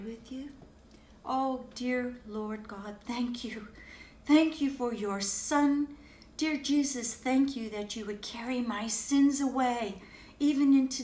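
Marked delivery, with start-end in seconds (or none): none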